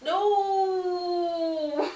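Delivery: high, sulky little no; pouting. Retold or imitated. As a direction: sulk it like a child denied sweets. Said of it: "And I was pouting".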